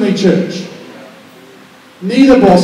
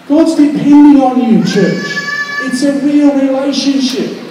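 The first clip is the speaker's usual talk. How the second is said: preaching at full force; 4 semitones higher than usual. Real, not acted. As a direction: preach it out to the congregation.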